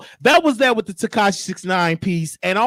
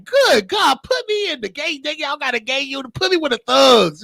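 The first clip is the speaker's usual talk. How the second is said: eager plea; 6 semitones higher than usual. Retold or imitated. Retells eager plea in jail.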